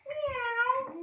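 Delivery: high mock meow again; cat meow. Shown as a demonstration. Teacher leading children in cat pose, playfully high meow. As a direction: squeak it like a kitten.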